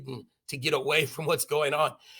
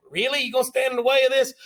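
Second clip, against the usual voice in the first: higher, louder, fast taunting challenge; mock tough guys; 7 semitones higher, 5 dB louder, faster than usual. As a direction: soldiers taunting Arnold with guns raised. Drunk soldiers threatening Arnold.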